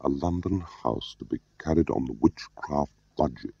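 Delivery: soft, slow, pondering reread. A narrator's reading. Smiley rereading the minute to himself, slowly and softly. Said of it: "Smiley read this again more slowly".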